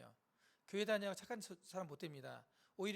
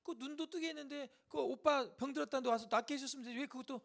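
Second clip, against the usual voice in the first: higher-pitched indignant complaint; doubting criticism; 7 semitones higher, 5 dB louder than usual. Acted. Bystanders criticizing Jesus over Lazarus, indignant. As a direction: question him like an aggrieved critic. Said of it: "의심하고 비판하는 겁니다".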